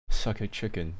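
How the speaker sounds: ordinary talk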